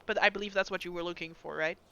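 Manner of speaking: ordinary delivery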